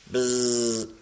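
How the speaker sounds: sustained bee buzz; droning insect noise; imitated buzz. A narrator's reading. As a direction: buzz steadily like a giant bee. Giant buzzing beast bursts in.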